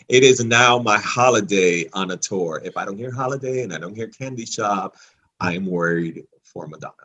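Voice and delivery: ordinary talk, nothing marked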